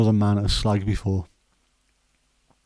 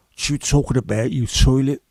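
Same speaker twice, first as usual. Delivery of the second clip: rough Cockney villain, louder and shouting the insult; gruff Cockney villain; 3 semitones higher, 5 dB louder than usual. Pretend. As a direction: snarl it like a Cockney heavy threatening a mate. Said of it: "really gruff voices".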